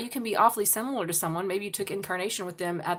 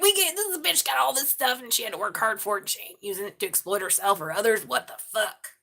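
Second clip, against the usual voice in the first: indignant grumbling demon voice; pissed-off demons. Retold or imitated. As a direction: rant as the annoyed demons. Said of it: "That's why the demons is pissed".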